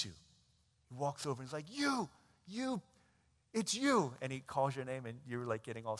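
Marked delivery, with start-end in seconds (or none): 1.7-4.1 high, startled accusing voice; surprised old boss. Acted. Old boss spotting his former servant at the food court, high and startled. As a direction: point him out, shocked.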